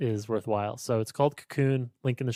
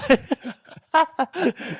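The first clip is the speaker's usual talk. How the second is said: burst of laughter; 13 semitones higher, 7 dB louder than usual. Real, not acted. Laughing at own misreading.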